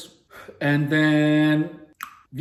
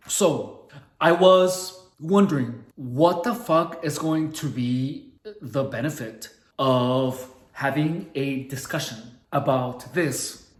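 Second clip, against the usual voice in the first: ordinary talk, nothing marked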